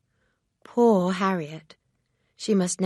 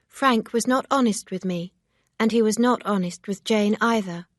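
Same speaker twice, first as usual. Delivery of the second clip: lightly raised voice for Emma; a hint of a firm young lady; Emma, lightly voiced, reproachful; 4 semitones higher, 5 dB louder than usual. A narrator's reading. Emma's voice in read story.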